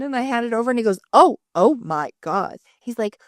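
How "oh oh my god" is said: slow, drawn-out stunned gasp; a lightly voiced husband overwhelmed by the page; amazed husband. Husband seeing her crowded mind map, slow and stunned.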